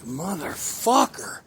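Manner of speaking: slow drawn-out curse; slow, stretched-out mock curse; dad's yell. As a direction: bellow it like an angry dad woken by a crash. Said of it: "and he was like".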